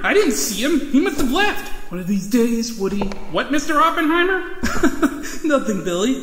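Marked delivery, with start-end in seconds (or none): 4.5-5.4 quick unvoiced snicker, much higher; a breathy giggle held back; sly snicker. Hiding his secret from Billy, a sly snicker.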